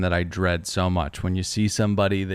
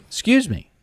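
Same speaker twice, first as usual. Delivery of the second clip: polite excuse me; 12 semitones higher than usual. Retold or imitated. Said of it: "and I say".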